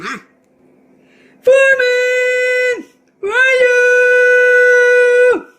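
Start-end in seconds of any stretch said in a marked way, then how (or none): 1.4-5.5 long drawn-out shout, much higher and louder; calling out. Acted. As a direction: owner calls far off for his missing dog, drawn-out shout. Calling for the lost dog.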